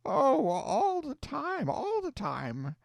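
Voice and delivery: high, slow, sobbing cry; wailing old aunt, tears in the voice. Acted. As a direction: let the grief burst out on oh. Hannah cries out in grief.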